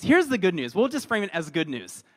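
ordinary talk, nothing marked

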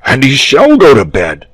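loud, high, sudden declaration; cried out. A narrator's reading. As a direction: burst out decisively. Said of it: "cried the dragon".

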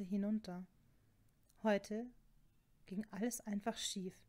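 ordinary delivery

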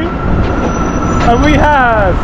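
high, loud mock calling out; hailing a taxi. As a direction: sing out for your taxi.

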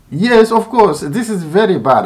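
high, sharp sarcastic yes; sarcastic of course; sarcastic agreement. Real, not acted. Sarcastic reply to YouTube email.

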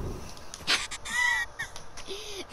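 high squealing laugh. Real, not acted. Big laugh.

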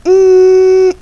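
high loud hum in place of the word; censor hum. Acted. Self-censoring virus on YouTube, humming bleep. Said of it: "I can't even call it by its own name".

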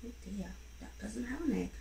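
ordinary delivery, nothing marked

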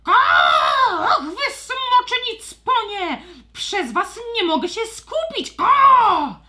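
very high, loud squawky complaint; cranky bird scientist. Acted. Stormbird scientist angrily complaining about the dragons' noise. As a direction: screech it out as an irritated old bird. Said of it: "Krzyknął burzoptak".

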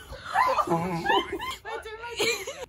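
high wheezing laugh near tears; crying laughter. Real, not acted. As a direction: laugh till you cry.

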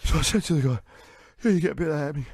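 mock-wheezing storyteller, puffing between phrases; out of breath. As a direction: act winded just from talking. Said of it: "gets him out of breath".

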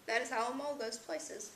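plain reading voice

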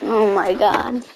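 ordinary delivery

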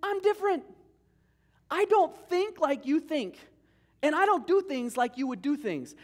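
high, loud, slowed paraphrase of God; God speaking. Acted. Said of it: "He says".

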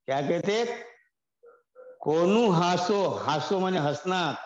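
ordinary talk

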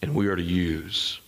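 low, earnest pastoral delivery; solemn appeal. Real, not acted. Pastor appealing to the folks he shepherds, solemn. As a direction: bring the pitch down and plead soberly.

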